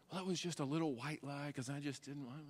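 soft, far-off sheepish excuse; half-hearted pretend alibi; mock excuse. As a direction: mumble a lame excuse.

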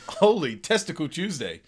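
lightly voiced zany exclamation; Ace Ventura voice. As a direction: blurt it out, goofy. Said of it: "the first thing he says is".

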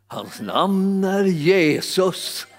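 very slow, drawn-out declaration of the name; solemn proclamation. Real, not acted. Preacher to listeners, slow reverent declaration of Jesus. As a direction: let each word land slowly and reverently.

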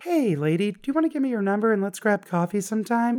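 high-pitched, eager mock invitation; mock over-friendly. Customer pretending to ask a shop worker for coffee, over-friendly.

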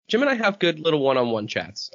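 usual speaking voice

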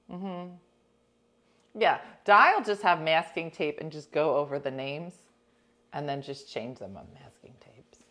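ordinary talk, nothing marked

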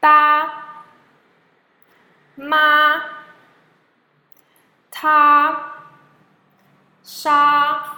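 high, level first tone on "Da, ma, ta, sha". Shown as a demonstration. Teacher modelling for students to repeat, "Da, ma, ta, sha" in high flat tone. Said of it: "blue and flat like the sky".

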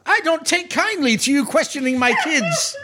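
high, indignant orphanage keeper; defensive matron. Acted. Matron defends her kids to strangers, indignant. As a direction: bristle in a high, protective voice. Matron in acted quarrel with adventurers.

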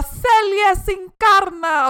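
high, loud, stretched-out announcer cry; drawn-out hall announcement; announcer call. Retold or imitated. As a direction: call the winners' name out slowly like an MC. Announcer calls out the team.

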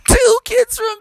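high scoffing laugh and shout; high jeering shout; mocking laughter. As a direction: laugh them off, disbelieving. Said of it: "who are gonna say".

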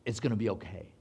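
soft, low reassuring line; calm a frightened man quietly; an old lady soothing a nervous passenger; gentle reassurance. Retold or imitated. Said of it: "she says".

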